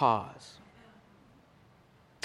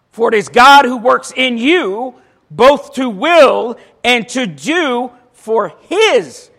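scripture reading; 6 semitones higher, 9 dB louder, slower than usual. Acted. Reads scripture verse aloud.

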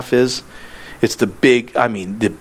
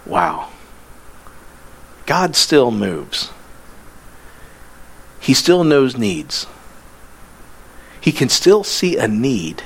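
awed reflection. Acted. Reflective awe, slow.

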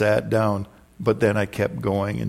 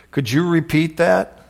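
heckler from the back; 6 semitones higher, 3 dB louder than usual. Retold or imitated. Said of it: "a guy from the back of the church says".

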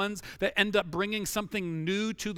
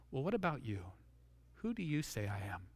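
lower, softer, drawn-in question; intimate probing question; 6 semitones lower, 9 dB quieter than usual. Acted. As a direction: ask it quietly, close to the listener. Jesus's probing question, voiced intimately.

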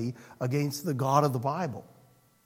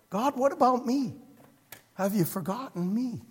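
pleading; 7 semitones higher than usual. Retold or imitated. Pleading prayer voiced.

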